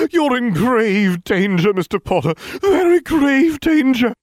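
high, loud, slow doom-laden warning; ominous seer. A narrator's reading. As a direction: draw out the prophecy like a theatrical seer. Trelawney warns Harry.

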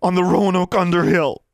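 high, loud, bitter accusation; rising voice. A narrator's reading. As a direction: voice climbing toward a shout. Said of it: "Harriet's voice rose".

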